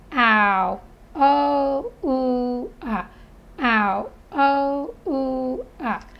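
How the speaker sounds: "Ow. Oh, ooh, ah" as separate, evenly held vowels; phonics sound drill. Shown as a demonstration. Teacher repeating O U sounds to be written, steady drill. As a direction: recite the vowel sounds one by one, in steady rhythm. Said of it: "I want you to hear the sounds".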